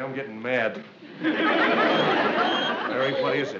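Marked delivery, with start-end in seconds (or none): 1.2-3.6 exasperated call. Acted. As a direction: let it ring out, fed up.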